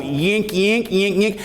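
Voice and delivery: repeated creaky yink sound; squeaky ramp noise. Retold or imitated.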